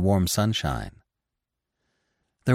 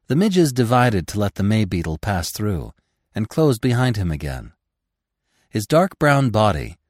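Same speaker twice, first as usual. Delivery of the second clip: ordinary delivery, nothing marked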